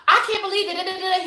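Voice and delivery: high-pitched imitation of her own ranting; mock yelling. Woman replaying her side of a fight with fiance, mock yelling. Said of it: "I'm screaming and yelling".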